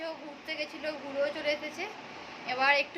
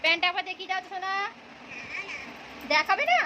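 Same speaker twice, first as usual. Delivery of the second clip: excited coaxing; 5 semitones higher, faster than usual. Real, not acted. Coaxing child to show pants.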